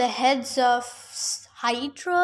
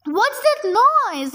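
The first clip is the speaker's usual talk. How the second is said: higher, slow called-out question; shouting; 7 semitones higher, slower than usual. A narrator's reading. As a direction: call it out over the noise. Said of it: "Annabeth shouted".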